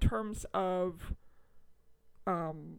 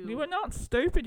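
reassurance in a put-on Australian accent; 7 semitones higher, 4 dB louder than usual. Reassuring a co-host who felt stupid, in an Australian accent. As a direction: console in high-pitched Australian at first. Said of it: "maybe I should say this not in an Australian accent".

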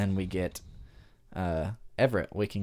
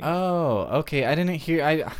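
higher, louder "oh" of realization; surprised realization; 8 semitones higher, 6 dB louder than usual. Real, not acted. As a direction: react as the missed reference suddenly clicks. Realizes the reference they missed.

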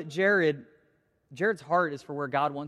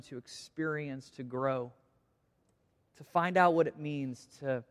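prayer. Acted. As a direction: pray it unhurried, softening at the ends. Pastor prays aloud to congregation.

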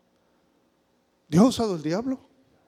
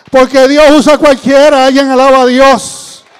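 full-voiced sermon cry; fiery preaching; 6 semitones higher, 17 dB louder than usual. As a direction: proclaim it to the congregation.

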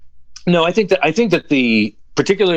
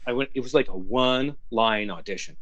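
ordinary talk

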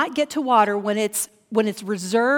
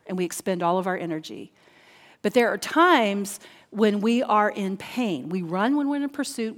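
ordinary talk, nothing marked

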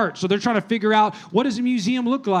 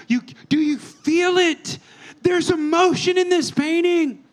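higher, slow, impassioned plea; passionate urging; 6 semitones higher, slower than usual. Retold or imitated. Connoisseur pleads with a stranger to feel the art, impassioned. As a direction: make him feel the painting.

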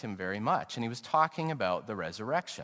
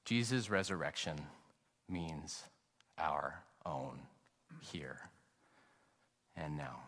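soft echo; 6 semitones lower, 10 dB quieter than usual. Acted. Preacher repeats the claim softly.

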